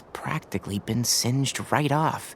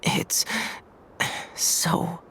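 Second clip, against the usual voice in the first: weak, soft, half-whispered moan; barely able to get the words out; faint from heat; 4 dB quieter, much slower than usual. Acted. Tighnari coming round after fainting in the heat, weak and faint.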